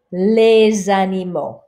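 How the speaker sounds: Les animaux with les linked as lez and no final X; French teacher demo, lez-animaux; liaison Z. Shown as a demonstration. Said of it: "don't pronounce the X".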